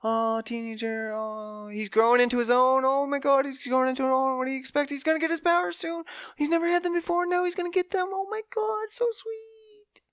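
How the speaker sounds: high sappy mock gush. Mocking the teen growing-up plot, high gushing coo.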